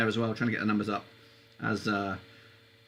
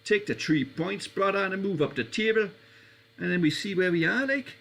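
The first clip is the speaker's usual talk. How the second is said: put-on Jamaican Geordie accent; 6 semitones higher than usual. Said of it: "bit of Geordie and a Jamaican".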